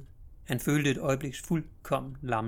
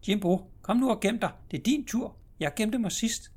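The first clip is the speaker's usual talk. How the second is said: put-on high, eager child's voice; much higher little sister calling out to play; little girl calling; 9 semitones higher, 4 dB louder than usual. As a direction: call to her brother like a small girl.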